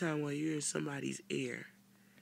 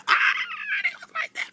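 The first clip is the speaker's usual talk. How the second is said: usual speaking voice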